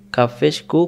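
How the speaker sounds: usual speaking voice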